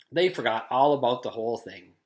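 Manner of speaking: ordinary delivery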